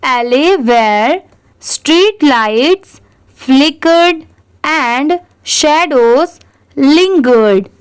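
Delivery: very slow, each word spaced out; teacher reading a line very slowly for students to repeat; slow read-aloud. Shown as a demonstration. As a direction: read it out slowly so they can repeat after you. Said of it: "आप मेरे बाद ज़रूर repeat करिए".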